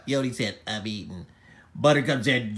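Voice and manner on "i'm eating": low, soft, unbothered mumble; uninterested reply. Acted. Yodi too busy eating, low uninterested reply. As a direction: brush it off without interest.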